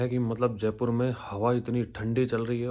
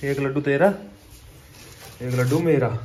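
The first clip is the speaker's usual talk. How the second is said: playful sharing; 3 semitones higher, 7 dB louder than usual. Real, not acted.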